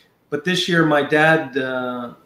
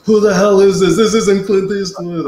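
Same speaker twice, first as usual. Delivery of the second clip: higher, louder indignant retort; angry Arnold; 5 semitones higher, 5 dB louder than usual. Retold or imitated. Arnold realizing prank call, higher and louder indignant outburst. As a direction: bark it like a star who's been fooled. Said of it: "And he goes".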